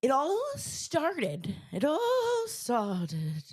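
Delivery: high, slow spooky storyteller. Acted. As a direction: drag it out like a ghost story. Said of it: "I gotta put the spooky voice on".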